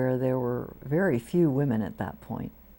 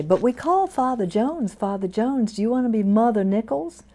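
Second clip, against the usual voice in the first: Texas church lady; 8 semitones higher, 5 dB louder than usual. Retold or imitated. Texas women asking about titles.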